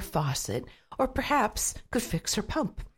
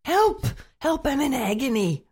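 high, loud wailing cries for help; hammed-up howl over a stubbed toe; shrieking in pain; 7 semitones higher, 4 dB louder than usual. A narrator's reading. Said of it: "he shrieked".

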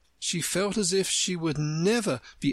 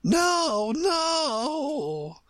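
high, drawn-out Queen's cry. A narrator's reading. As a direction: call out urgently, stretching each word. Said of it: "cried the Queen".

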